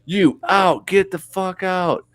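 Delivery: high, slowed imitation of a furious singer; mock yell. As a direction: throw him out like an angry frontman. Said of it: "he's saying all this and yelling".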